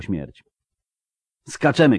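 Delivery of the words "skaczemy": raised, high-pitched command; urgent shout. A narrator's reading. Geralt ordering a jump under fire, shouting. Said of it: "krzyknął".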